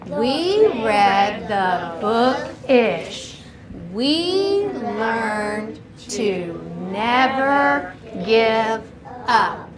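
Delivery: very slow, stretched-out words; slow choral reading. Shown as a demonstration. Teacher leads class rereading the message, slow and drawn-out. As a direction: lead readers along word by word.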